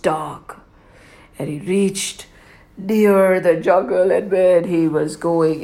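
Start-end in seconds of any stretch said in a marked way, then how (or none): none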